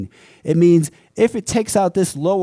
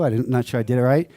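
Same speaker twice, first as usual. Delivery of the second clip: ordinary delivery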